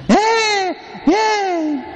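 put-on high wailing Ñe, Ñe from a baby; mock baby crying. Preacher imitating the baby crying on the plane, high wail. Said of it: "comienza la lloradera".

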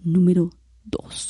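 slow whispered Dross impression. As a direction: breathe out "número dos" slowly. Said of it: "No me sale la voz del Dross".

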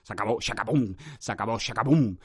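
low pronouncement. Real, not acted. To the mic, on phrases dying out, hushed low. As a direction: drop to a low doom-laden hush.

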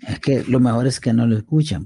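usual speaking voice

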